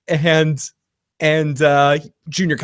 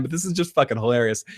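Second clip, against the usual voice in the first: ordinary talk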